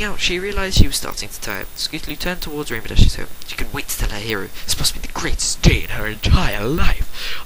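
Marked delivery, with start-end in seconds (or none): 4.6-7.4 slow whisper, dreamy; awed whisper. A narrator's reading. Narrator savoring the filly's happiest day, slow whisper. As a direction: hush it in wonder.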